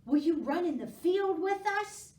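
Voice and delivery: dog inviting Squirrel to run. A narrator's reading. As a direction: ask eagerly like a dog. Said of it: "said Dog".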